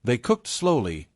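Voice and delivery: ordinary delivery, nothing marked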